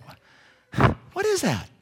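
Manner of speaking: whispered then high alarm; startled. Acted. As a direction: caught off guard again. Couple startled again.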